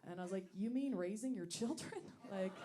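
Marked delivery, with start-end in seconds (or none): none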